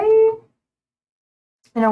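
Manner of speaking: sing-song mock knowing; teasing you know. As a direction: tease the viewer with a knowing lilt. Teasing viewer about winter.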